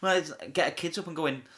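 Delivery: ordinary talk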